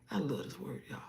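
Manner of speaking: soft, breathy devotion; devoted whisper. Real, not acted. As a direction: whisper it with reverence. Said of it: "whispered devotion".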